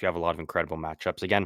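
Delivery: ordinary talk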